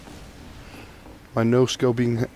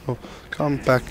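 normal talking voice

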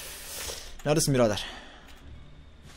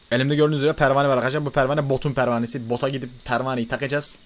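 ordinary talk, nothing marked

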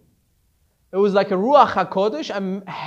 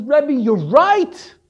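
loud, tearful confession; tearful outburst; 4 semitones higher, 8 dB louder than usual. Retold or imitated. Disciple loudly confessing to his rebbe, tearful. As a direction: cry it out to the rebbe, swearing off money. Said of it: "he starts to cry and he says".

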